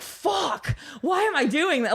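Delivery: high-pitched mock freak-out; retold panic. Newcomer to herself before facing her idol, mock panicked. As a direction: replay your own panic, laughing a little.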